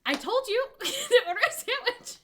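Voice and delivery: high, loud shout cracking into laughter; laughing mock shout. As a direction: yell it through laughter. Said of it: "the second one was like".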